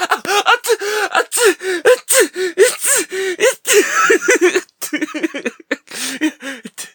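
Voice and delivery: high, loud yelps at scalding soup; hot yelps. Acted. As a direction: yelp as if burned. Hot soup hits the glove.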